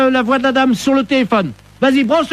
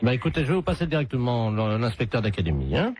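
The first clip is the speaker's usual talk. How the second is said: low, muffled official on the phone; deep disguised voice; 14 semitones lower, 12 dB quieter than usual. Acted. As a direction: drop the voice low and stiff. Prank caller disguises voice.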